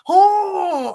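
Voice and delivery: high, loud startled oh; the penny drops all at once; sudden realization. Retold or imitated. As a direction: exclaim it like the penny finally dropped. Sudden realization in retold scene.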